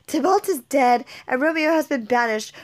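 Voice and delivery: "Tybalt is dead" flung out loud, with "has" hit hard; anguished outcry. Acted. Juliet to the Nurse, crying out the news in anguish.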